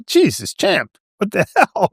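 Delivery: high startled exclamation breaking into a laugh; retold surprise with a laugh on hell. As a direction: caught off guard and laughing it off. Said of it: "and I go".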